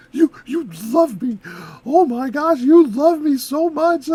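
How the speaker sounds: gasp, then loud, gushing mock amazement; hammed-up lovestruck gushing; pretend overwhelmed delight. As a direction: act bowled over, drawing out the last words. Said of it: "be instantly like".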